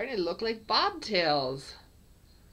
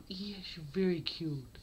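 whispered praise. Real, not acted. Cooing to a kitten.

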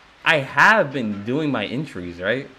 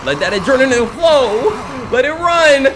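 high, loud yell climbing higher; hyped-up shouting; 10 semitones higher, 5 dB louder than usual. Pretend. Gamer hypes himself while fleeing, hyped-up shouting. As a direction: build up to a shout.

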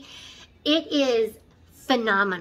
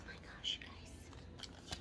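breathless whisper; 26 dB quieter, slower than usual. Real, not acted. Revealing favourite palette.

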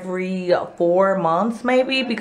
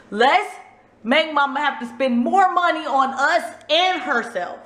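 put-on higher, playful scheming kids; 7 semitones higher than usual. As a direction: voice the kids plotting mischief. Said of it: "they're like".

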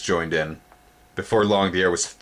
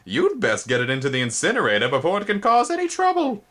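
stern insect; 11 semitones higher than usual. A narrator's reading. Spindlebug urging incinerator, high firm insect voice. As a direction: order it briskly.

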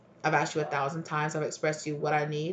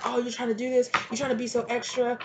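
mocking dismissal; 6 semitones higher than usual. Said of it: "it's like".

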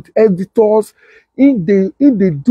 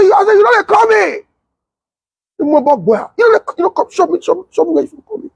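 retold angry shout; 11 semitones higher than usual. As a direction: shout him down in mock outrage. Heated retold exchange with Mayaki.

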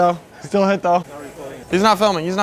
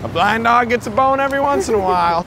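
mock catchphrase, pitched up; Tommy G; 6 semitones higher than usual. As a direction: imitate a friend crowing his motto. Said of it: "whenever Tommy G gets pussy, he's like".

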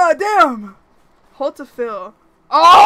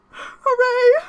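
tearful cheer; 12 semitones higher, 7 dB louder than usual. Real, not acted. Winning the hard fight.

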